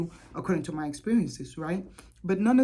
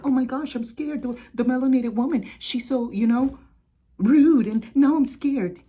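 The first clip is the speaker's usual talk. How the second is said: higher, hammed-up scared victim, peaking loud on rude; mock her playing the frightened victim; 6 semitones higher, 5 dB louder than usual. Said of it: "they go victim mode".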